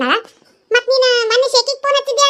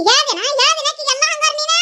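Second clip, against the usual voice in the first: shouting at her; 6 semitones higher than usual. Acted. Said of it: "ಯಾಕ್ ಒದರ್ತಿ?".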